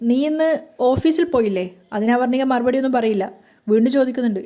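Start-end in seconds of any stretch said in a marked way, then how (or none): none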